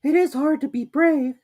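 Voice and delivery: small piglet close to sniffles; timid piglet sniffing. A narrator's reading. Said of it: "sniffing slightly".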